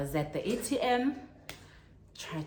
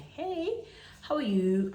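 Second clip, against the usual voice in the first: cheery greeting; 9 semitones higher, slower than usual. Retold or imitated. Voicing the greeting couple.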